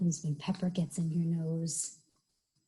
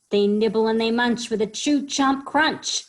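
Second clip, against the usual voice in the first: put-on emphatic, louder children's storytime; lively story reading; 5 semitones higher, 7 dB louder than usual. A narrator's reading. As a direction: punch out each munching verb.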